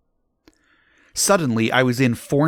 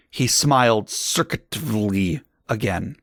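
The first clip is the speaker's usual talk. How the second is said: plain reading voice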